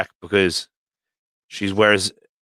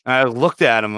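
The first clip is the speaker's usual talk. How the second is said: usual speaking voice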